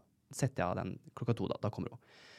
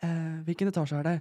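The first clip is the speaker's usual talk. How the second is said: higher, puzzled mock question; imitating lost friend; 7 semitones higher than usual. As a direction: ask it like you're lost in the building, pitched up. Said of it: "så ringer hun meg". (lett etterligning av venninne som har gått seg vill)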